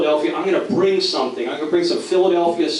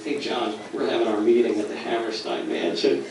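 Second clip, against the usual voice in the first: low, much softer, casual; understated phone boast; 7 semitones lower, 6 dB quieter than usual. Retold or imitated. Speaker casually tells rival John about the meeting venue, understated and low. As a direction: drop it low and quiet, offhand.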